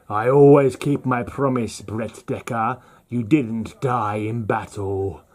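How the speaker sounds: Death. Acted. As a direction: deliver the verdict as Death, slowing down. Voices Death closing the story.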